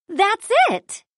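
high excited realisation; idea moment. A narrator's reading. Minnie gets her plan, high excited realisation. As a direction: it just clicked, say it high. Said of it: "Minnie has a plan".

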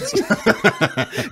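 a short high burst of laughter, real; amused laugh. Real, not acted. Podcast host laughing at the zombie-movie comparison, amused laugh. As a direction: laugh out loud at the joke.

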